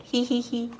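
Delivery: high, acted princess giggle; storybook giggle. A narrator's reading. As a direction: giggle daintily as the princess, spelled out. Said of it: "and she's giggling".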